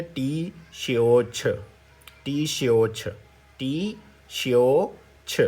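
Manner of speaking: slow, drawn-out repeated model pronunciation; syllable-by-syllable demo. Shown as a demonstration. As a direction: sound out ٹی, شیو, چھ one by one. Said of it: "تو کیا بولیں گے". (ٹی، شیو، چھ کو الگ الگ آہستہ بولیں)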